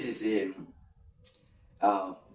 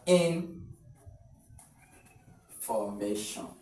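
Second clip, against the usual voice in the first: slow, quiet, spelled-out "information"; teacher reading the option slowly; slow drawn-out read; 3 semitones lower, 3 dB quieter, much slower than usual. Shown as a demonstration. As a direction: draw "information" out slowly syllable by syllable. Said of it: "you stress such words at the second syllable".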